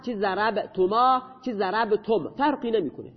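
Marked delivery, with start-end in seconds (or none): none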